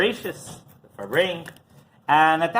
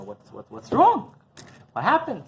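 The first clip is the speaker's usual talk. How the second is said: grandmother's anxious question, pitched higher; worried grandmother; 8 semitones higher than usual. Retold or imitated. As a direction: ask what's wrong like a concerned wife. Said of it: "And my grandmother asks him".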